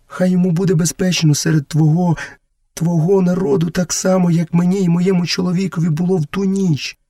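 high-pitched mother asking a blessing; pleading mother. Acted. Mother begs the jungle god to protect her son, pleading. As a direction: plead for your child, pitched well up.

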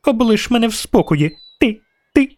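put-on very high, indignant child voice; squealing little girl. A narrator's reading. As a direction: pitch it way up like a child protesting. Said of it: "досить цього вереску".

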